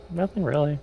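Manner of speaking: usual speaking voice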